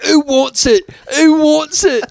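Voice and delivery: high, loud mock challenge to the street; Scottish guy yelling. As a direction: bellow it like a tweaking stranger. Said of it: "he's like".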